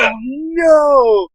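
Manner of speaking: a half-joking gulp of fear; scared. Retold or imitated. Caught at the door, scared.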